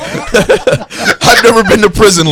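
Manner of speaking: high, slow, laughing protest. Real, not acted. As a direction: laugh it out defensively.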